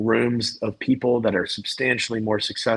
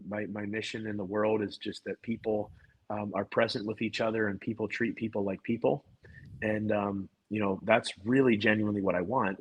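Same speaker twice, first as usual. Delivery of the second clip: hushed, gentle sincerity; soft, disarming; 8 dB quieter than usual. Retold or imitated. Salesman answering an angry homeowner at the door, soft and sincere. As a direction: answer softly to calm someone down.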